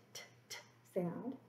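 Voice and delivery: crisp repeated "t" sounds; sounding out t. Shown as a demonstration. Teacher sounding out the letter t. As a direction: tap out "t, t" softly. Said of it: "Tree starts with the t".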